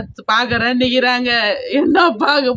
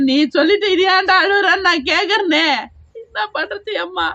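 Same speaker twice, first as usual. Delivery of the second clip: crying; 6 semitones higher than usual. Real, not acted. Grandmother crying over loss.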